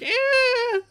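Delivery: a high strained whine; mock pained cry. Joking about a loud megaphone, with a high squeal. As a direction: squeal like ringing ears. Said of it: "Your ears are like".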